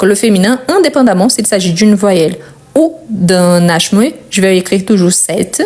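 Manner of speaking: ordinary talk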